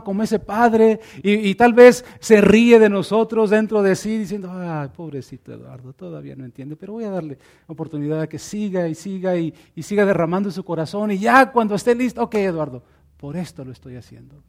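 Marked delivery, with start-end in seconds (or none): 4.5-14.4 much lower and softer, fond and patient; tender fatherly God. Pretend. God chuckling kindly over Eduardo's tears, tender and low. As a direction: talk like a father amused by his child.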